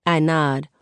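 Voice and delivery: usual speaking voice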